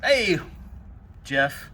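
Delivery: ordinary delivery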